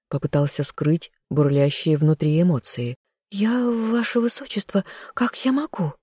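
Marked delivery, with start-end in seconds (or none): 3.3-5.9 high, timid maid's voice; cornered servant girl, pitched high; frightened, cornered. A narrator's reading. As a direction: falter helplessly before a master. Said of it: "Затравленно смотря на пирог, пробормотала девушка".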